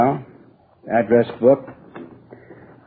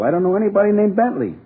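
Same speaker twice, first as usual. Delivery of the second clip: insist, pitch leaping up in protest; exasperated denial; 11 semitones higher than usual. Acted. Suspect repeats his denial to police, exasperated. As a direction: voice soaring high on the name. Denial escalates under questioning.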